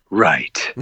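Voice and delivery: a low, dry, unimpressed "Right."; imitating Vernon Wells. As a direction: drop the voice low and brush the kid off with one word. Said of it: "exactly how he said it".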